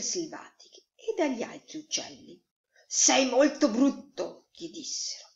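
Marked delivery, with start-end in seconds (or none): 2.9-4.5 higher, louder, slow character voice; blunt wild birds. A narrator's reading. Wild birds size up the newcomer, blunt. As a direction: pronounce it slowly in a raised bird voice.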